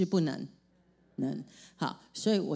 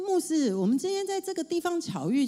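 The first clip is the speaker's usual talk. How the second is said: excited exclaim; 9 semitones higher than usual. Retold or imitated. Sister exclaims at meeting.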